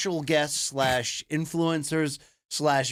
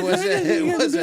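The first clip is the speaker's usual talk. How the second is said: laughing; 6 dB louder than usual. Real, not acted. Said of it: "laughing high".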